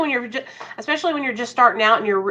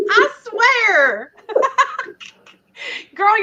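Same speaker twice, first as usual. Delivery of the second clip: high, laughing exclamation; amused laughing; 7 semitones higher, much slower than usual. Real, not acted. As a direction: say it through real laughter. Both laughing at pregnancy news.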